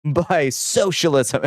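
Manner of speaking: high-pitched mock outrage; mock furious. Establishment Democrats decrying socialist wins, mock furious. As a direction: cry scandal in a put-on indignant voice. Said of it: "Democrats are absolutely fucking furious".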